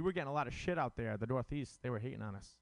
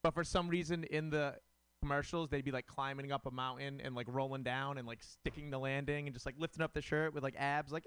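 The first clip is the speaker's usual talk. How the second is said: normal talking voice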